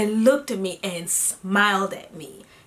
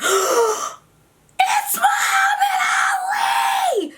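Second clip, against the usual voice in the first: high, slow, half-crying shout of joy; starstruck shout; 3 dB louder, much slower than usual. Retold or imitated. Starstruck girl spotting Muhammad Ali at the bar, shrieking. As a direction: blurt it out at full voice, overwhelmed. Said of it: "how loud I said this".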